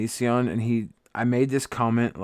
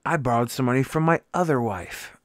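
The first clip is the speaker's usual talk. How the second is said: ordinary talk, nothing marked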